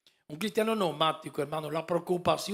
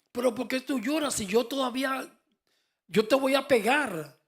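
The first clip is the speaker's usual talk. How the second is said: stern father; 5 semitones higher than usual. Retold or imitated. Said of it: "y yo le dije".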